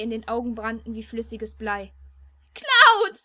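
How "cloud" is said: very high, loud cry; anguished scream. A narrator's reading. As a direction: scream his name in despair. Tifa screams his name in tears.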